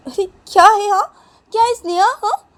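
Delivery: put-on high, loud indignant girl's voice; squeaky outraged sister; shrill protest. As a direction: push back, voice way up. Neha protests being slapped.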